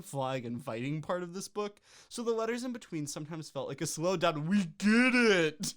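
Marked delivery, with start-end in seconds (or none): 4.5-5.7 loud, slow exasperated yell; exasperated shout. Real, not acted. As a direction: drag it out in exasperation. Exasperated outburst.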